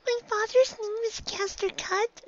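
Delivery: high little filly voice; a small girl pressing her mother; high-pitched child pony insisting; young filly. A narrator's reading.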